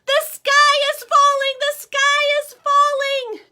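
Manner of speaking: high loud panicked scream. Acted. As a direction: scream it as they flee. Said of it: "Chicken Little and Henny Penny screamed".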